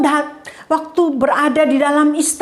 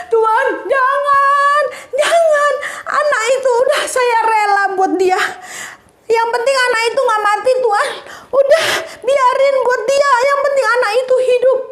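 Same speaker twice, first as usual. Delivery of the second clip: crying mother; 10 semitones higher than usual. Acted. The real mother begs king Solomon not to cut the child, sobbing high. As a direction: beg the king through sobs to spare the baby. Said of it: "ibu yang satu yang dari tadi nangis".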